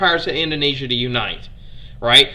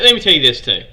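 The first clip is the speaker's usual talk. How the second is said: ordinary delivery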